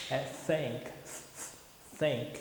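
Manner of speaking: "thank" soft and low, with air through the teeth; a quiet "thank" with the tongue between the teeth; correct th. Shown as a demonstration. Teacher models the right th after the wrong ones. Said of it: "não é thank".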